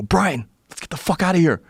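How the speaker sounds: high-pitched urgent plea to flee; urgent rousing. Retold or imitated. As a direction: rouse a sleeping friend in a panic. Retold urgent waking of friend.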